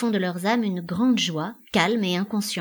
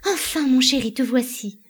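high, louder relieved cry; 5 semitones higher, 4 dB louder than usual. A narrator's reading. Elida turns to greet her darling, crying out. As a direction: exclaim on turning round, voice lifted. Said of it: "Elida se retourne et s'écrie".

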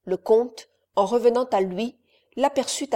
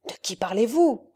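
worried. Acted. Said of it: "répéta Conrad inquiet".

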